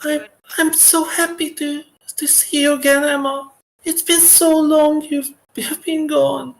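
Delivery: put-on very high young girl voice; little girl voice. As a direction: squeak it out like a small girl. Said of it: "Your little young girl voice".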